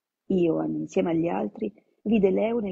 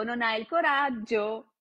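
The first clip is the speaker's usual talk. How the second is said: drawn-out mocking repeat of "non hai il coraggio", higher, slower; slow singsong taunt; 4 semitones higher, slower than usual. Leo repeating the dare to Ioan, slow singsong taunt. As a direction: stretch the tease out like a nursery chant. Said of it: "Canzonò Leo".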